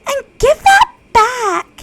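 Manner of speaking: very high, loud, panicky; embarrassed girl shouting at a classmate; Natsuki yelling. Acted. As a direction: shriek to stop her. Natsuki voiced, panicked protest.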